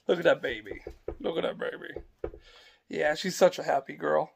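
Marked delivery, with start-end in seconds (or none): 1.2-2.0 whisper. Real, not acted. Dad leaning over his baby, whispering tenderly. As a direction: breathe it without voice.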